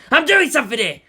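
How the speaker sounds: loud, high shout; robber shouting. A narrator's reading. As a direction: yell everyone down, desperate. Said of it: "the robber shouted".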